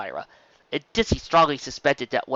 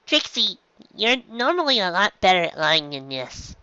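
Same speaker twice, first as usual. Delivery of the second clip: dry mare voice; 6 semitones higher than usual. A narrator's reading. Dizzy voice.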